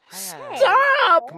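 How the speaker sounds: high sharp yell of stop. A narrator's reading. Sister yells to stop the costly call. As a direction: cut him off with a cry. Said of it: "my sister yelled".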